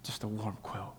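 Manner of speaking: low, near-whispered, cosy phrase; hushed savouring. Shown as a demonstration. Preacher letting the comfort of a quilt sink in, hushed. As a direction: let it drop almost to a whisper.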